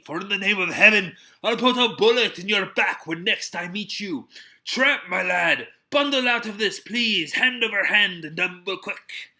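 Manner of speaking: loud, high, commanding fury; angry rebuke. A narrator's reading. Captain furiously ordering Silver out, loud and high. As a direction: drive him out with each line.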